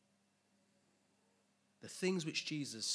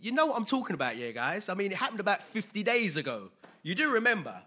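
higher, louder Peter pressing the crowd; Peter pressing them; 6 semitones higher, 8 dB louder than usual. Retold or imitated. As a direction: Peter insisting they remember. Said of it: "and he's like".

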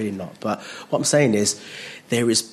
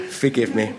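ordinary delivery

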